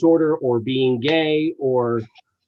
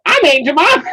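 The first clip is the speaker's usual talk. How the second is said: high mock character shout; hammed-up sketch catchphrase; Aunt Jemima; 10 semitones higher, 4 dB louder than usual. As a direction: imitate it high, in a caricature voice. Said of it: "The line in the skit is her going".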